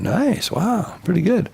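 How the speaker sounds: admiring visitors. Retold or imitated. Babylonians admiring the gold.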